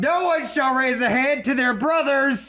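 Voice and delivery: cold rasping evil emperor. Acted. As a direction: bark the order like a warlord. King proclaims rule.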